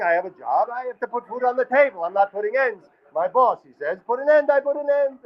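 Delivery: high, fretful Yiddish mobster rambling; a Yiddish gangster talking himself out of the job; Yiddish mobster accent. Shown as a demonstration. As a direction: Yiddish accent, whiny and apologetic. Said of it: "than Yiddish".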